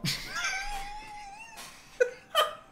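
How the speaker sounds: high shrieking laughter, out of control; helpless laughing fit. Real, not acted. Giggling fit while streaming a strategy game, shrieking laughter. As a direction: lose it completely, cackling. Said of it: "I'm suddenly giggling at that".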